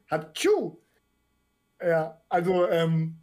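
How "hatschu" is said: loud real sneeze; one sharp sneeze; sneezing. Real, not acted. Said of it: "Oh, ich muss niesen".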